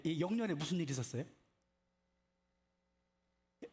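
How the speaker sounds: normal talking voice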